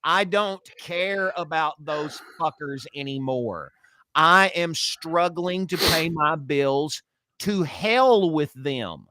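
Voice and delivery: high, slow, mock-angry rant; fed-up American. Host voices angry Americans complaining about Ukraine money, high and slow. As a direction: shout it out like a fed-up taxpayer. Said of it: "us Americans are sitting back going".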